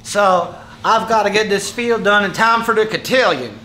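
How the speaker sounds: Southern accent. Shown as a demonstration. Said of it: "So try, you know".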